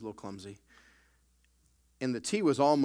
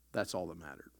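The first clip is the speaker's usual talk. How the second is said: soft, falling, much quieter; quiet echo; 7 semitones lower, 8 dB quieter than usual. Acted. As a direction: let it drop low and soft. Same words, quiet reflective echo.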